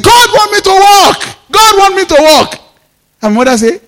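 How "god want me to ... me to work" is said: elated cry. Retold or imitated. Preacher waking from the trance, crying out in joy. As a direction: shout it like great news.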